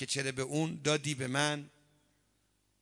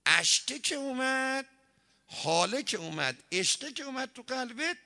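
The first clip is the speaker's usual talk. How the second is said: rising preaching; 11 semitones higher, 6 dB louder than usual. Real, not acted. Preacher builds to a climax.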